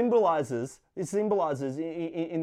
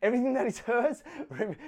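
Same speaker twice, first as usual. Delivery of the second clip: higher, laughing through the retake; 5 semitones higher, faster than usual. Shown as a demonstration. To the church after his joke, laughing retake. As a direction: correct the joke with a laugh breaking in. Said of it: "I'm kidding".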